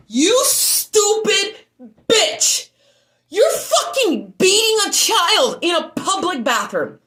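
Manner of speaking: slow, loud, high-pitched outrage; furious shout. Retold or imitated. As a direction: hurl each word in fury, drawn out. Confronting mother hitting child.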